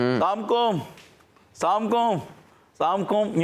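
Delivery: high, hurried السلام علیکم clipped to "سام کم"; mock the lazy greeting said while walking past; careless passing greeting. Teacher mocking kids who mumble a high سام کم. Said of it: "یوں کر رہے ہوتے ہیں آتے جاتے".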